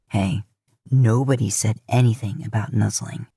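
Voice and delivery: a hint of mock complaint to the nuzzling moose; joking without heat. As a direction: pretend to complain, but fondly. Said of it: "Dean jokes without any heat".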